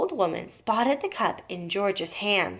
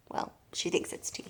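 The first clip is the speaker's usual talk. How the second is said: whispered aside; 11 dB quieter than usual. Real, not acted. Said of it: "aside to child".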